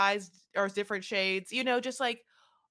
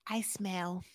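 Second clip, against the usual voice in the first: unvoiced whispered aside; 3 semitones lower, 7 dB quieter than usual. Playing the boyfriend, whispering under her breath. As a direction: whisper it in character.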